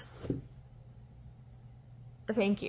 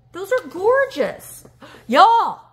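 higher, louder and slow; gushing; 8 semitones higher, 9 dB louder, much slower than usual. Real, not acted. Admiring necklaces.

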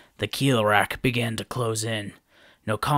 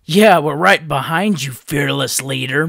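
louder, higher sarcastic jab; mocking; 4 semitones higher, 9 dB louder than usual. A narrator's reading. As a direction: needle the leader. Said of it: "Matau said mockingly".

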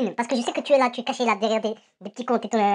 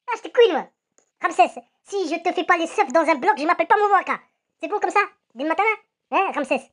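ordinary talk

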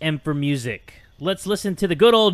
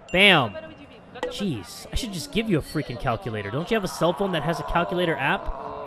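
ordinary talk

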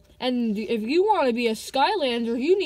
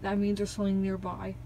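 ordinary talk, nothing marked

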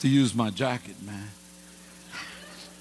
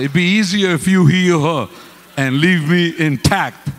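mock pleading; 4 semitones higher, 8 dB louder than usual. Praying to keep his kidney, mock pleading. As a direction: bargain with God half joking.

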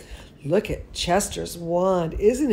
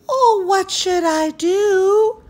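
higher, slow pleading fairy voice; lightly voiced fairy asking nicely; asked nicely; 11 semitones higher, 3 dB louder, slower than usual. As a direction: ask sweetly like a little fairy in trouble. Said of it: "Camellia asked nicely".

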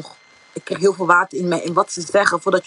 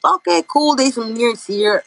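higher, brighter inner voice; light, cheerful relief at seeing him; relieved excitement; 5 semitones higher than usual. Retold or imitated. Girl reassured by the lifeguard, mildly excited.

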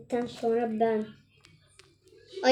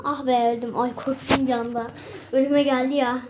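ordinary talk

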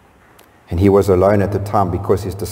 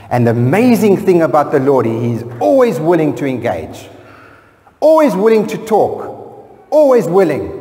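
rising, louder preaching build; fervent preaching; 7 semitones higher, 3 dB louder than usual. Acted. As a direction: climb with conviction on each always.

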